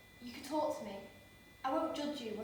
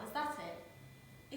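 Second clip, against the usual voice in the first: usual speaking voice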